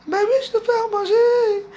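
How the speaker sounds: high, tearful voice breaking; crying. Real, not acted. Crying as she answers someone offering her food. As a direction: cry the words out.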